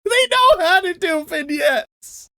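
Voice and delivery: laughing, exasperated high shout; frustrated. Real, not acted. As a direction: yell through laughter at the studio. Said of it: "you were a little frustrated".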